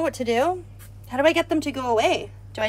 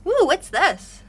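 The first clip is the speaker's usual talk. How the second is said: surprised; 7 semitones higher, 6 dB louder, slower than usual. Real, not acted. Finds something strange.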